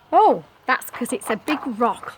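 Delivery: plain reading voice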